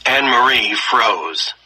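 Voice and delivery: ordinary delivery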